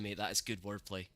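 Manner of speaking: usual speaking voice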